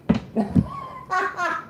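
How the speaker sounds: very high, gleeful witchy cackle. Real, not acted. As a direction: cackle at them with glee. Laughs at them on request.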